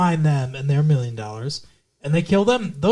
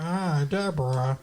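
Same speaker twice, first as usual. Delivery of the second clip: failed Ray impression; 7 dB quieter, slower than usual. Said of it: "I can't do it".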